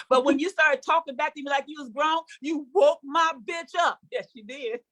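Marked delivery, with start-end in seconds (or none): none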